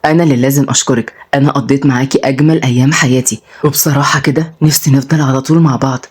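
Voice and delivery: low, earnest male character voice; Odai, affectionate. Acted.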